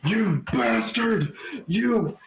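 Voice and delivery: high, slow screaming curses at the sky; anguished howl of fury; furious scream. Acted. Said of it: "screaming at the sky".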